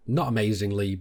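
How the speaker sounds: usual speaking voice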